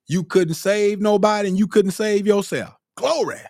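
high-pitched, exclaiming sermon peak; fervent preaching. Real, not acted. Preacher tells congregation they couldn't save themselves, fervent preaching. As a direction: preach it high, capped with a cried-out praise.

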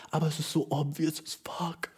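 conspiratorial whisper. Retold or imitated. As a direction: whisper it as a secret aside. Voicing angry girlfriend aside. (geheimes Flüstern)

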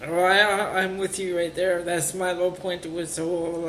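ordinary talk, nothing marked

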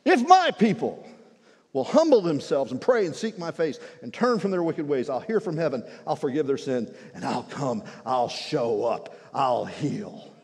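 fiery revival preaching; preaching at full force. Real, not acted. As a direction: drive it home to the whole church. Preaching continues.

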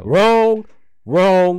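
loud, high game-show "wrong"; loud buzzer call. Pretend. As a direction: shout it like a buzzer sounding. Quiz host marking wrong answers.

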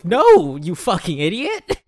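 hammed-up outburst at the boss; mock furious Olivia. Imitating Olivia yelling at her boss, mock fury. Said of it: "just Olivia going".

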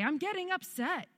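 high-pitched retold announcement; announcing it aloud. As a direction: imitate announcing your upset, pitch jumping up. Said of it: "Now I say".

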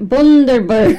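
put-on Bundaberg tried in a loud Aussie accent; Aussie accent, stumbling into Bundaberg. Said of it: "Do it with an, an Aussie accent".

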